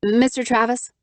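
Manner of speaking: a crisp, businesslike address; crisply. A narrator's reading. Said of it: "I asked crisply".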